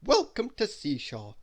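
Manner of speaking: oily, fawning greeting; butter up the guest; smarmy. Acted. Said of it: "smiled Mister Arthur Loaf smarmily".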